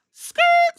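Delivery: very high, loud screech of brakes; tire skid. Real, not acted. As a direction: hit the brakes with your voice. Sound effect after rematch.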